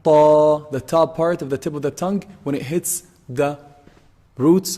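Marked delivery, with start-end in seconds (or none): 0.0-0.6 loud, held "Ta" with a heavy Arabic t; heavy letter ta. Shown as a demonstration. Teacher demonstrating for students, "Ta" loud and heavy. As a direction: hit the roots of the upper incisors on a heavy "Ta". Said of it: "It's a heavy letter".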